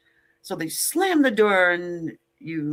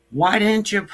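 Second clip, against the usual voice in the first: laughing. Real, not acted. Laughing at her own joke.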